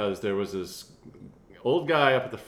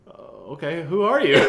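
1 puzzled; 6 semitones higher, 5 dB louder than usual. Retold or imitated. Retelling meeting the lobster lady.

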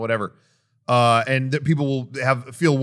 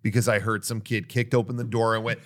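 ordinary delivery

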